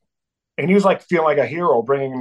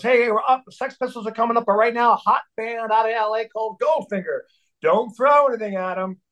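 higher-pitched imitation of a stage promoter; hype announcer; 7 semitones higher than usual. Radio DJ introducing the band, hyped announcer. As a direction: hype up the crowd as the emcee.

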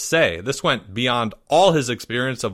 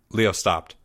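plain reading voice

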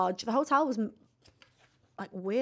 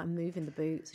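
sneaky aside; 4 semitones lower, 7 dB quieter than usual. Retold or imitated. Retold sneaky prank aside to mum.

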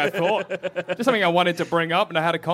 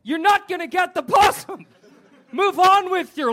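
mock yell at dog; 10 semitones higher than usual. Yelling at the dog Melody.

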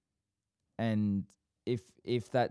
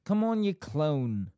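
higher, slow Bolton attempt landing on "clone"; botched Bolton accent; 6 semitones higher, 4 dB louder than usual. Shown as a demonstration. Host copying co-host, botched Bolton accent. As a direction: overdo a poor Bolton "clone". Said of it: "A little bit worse".

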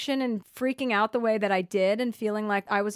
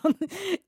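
high laugh; 10 semitones higher than usual. Real, not acted.

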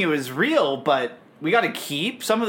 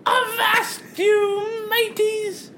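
high, slow wailing rat death cry; overacted death scene; 13 semitones higher, much slower than usual. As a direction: ham up a dying pirate rat. Mickey the Rat shot, dying.

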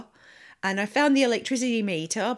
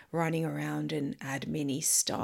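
whispering; 4 semitones lower, 8 dB quieter than usual. Real, not acted. Tired aside.

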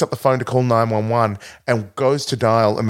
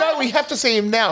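high-pitched urgent protest; mock insistence; 12 semitones higher than usual. Patient argues with the receptionist, mock urgency. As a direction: imitate a frantic patient insisting.

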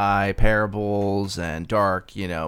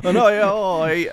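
slow, higher Australian; mock Australian; 9 semitones higher, 4 dB louder, slower than usual. [name] echoing the Aussie slip, slow and higher. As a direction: stretch it out Aussie. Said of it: "now I'm Australian".

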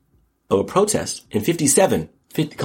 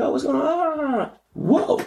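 much higher, slow, hammed-up innocent curiosity; imitating himself; much slower than usual. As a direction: play a wide-eyed guy wandering in. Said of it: "I'm like".

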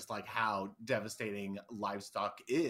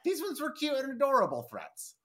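much higher cutesy voice; cutesy gushing; 13 semitones higher, 3 dB louder than usual. Real, not acted. Cohost fondly mocking the adorable plant threats, cutesy gushing. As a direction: gush over them in a high little voice.

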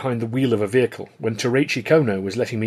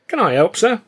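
a hint of Cockney, eager and high; Cockney lad offering help brightly; light Cockney lilt, optimistic; slight Cockney; 10 semitones higher, 6 dB louder than usual. A narrator's reading. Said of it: "a slight Cockney accent gave me a more optimistic tone".

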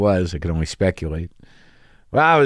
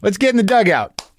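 higher mock coach calling the team in; imitating the coach; 10 semitones higher than usual. Coach calls the comedian back to the dugout, in a hammed-up coach voice. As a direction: round up the kids like a Little League coach. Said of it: "And he says".

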